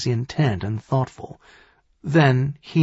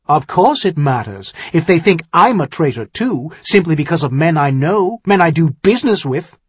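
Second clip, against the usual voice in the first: loud, higher, heated argument; indignant retort; 5 semitones higher, 6 dB louder than usual. Acted. Son-in-law objects to being thought a traitor, indignant. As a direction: argue back hotly, louder and higher. Accused man argues back, offended.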